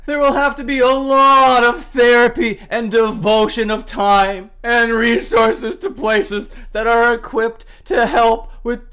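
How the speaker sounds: fake-weep through the statement to mock it; sarcastic sobbing sincerity; utter contempt. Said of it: "read this last sentence with utter contempt".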